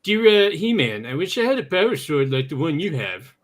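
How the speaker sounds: put-on mild Canadian accent, tagging eh on the ends. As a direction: read the letter as a polite Canadian kid. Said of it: "Do your Canadian accent, eh?".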